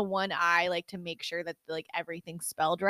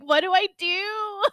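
high, drawn-out mock despair; retold helpless panic; 12 semitones higher than usual. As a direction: wail it slowly as a hammed-up drunk plea. Retells drunken helpless password panic.

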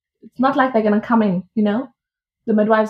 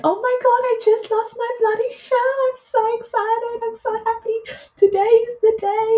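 squealing high with excitement; high-pitched thrilled squeal down the phone to her partner; excited squeal; 14 semitones higher than usual. Retold or imitated. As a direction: gush the news like you can't contain it. Retelling excited call to partner.

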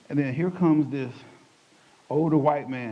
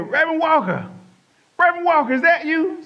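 high, loud calling out a name; a stranger calling out to him in a store; calling out across a store; 7 dB louder than usual. Retold or imitated. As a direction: call to him from across the room.

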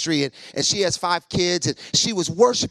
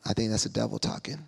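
hushed reply; 6 semitones lower, 6 dB quieter than usual. Retold or imitated. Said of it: "And I'm like".

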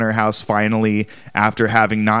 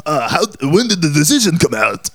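high, frail put-on old lady voice; a quick taste of her voice; mock Eastern European granny; old Eastern European woman; 5 semitones higher than usual. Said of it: "Can you give us a taste?".

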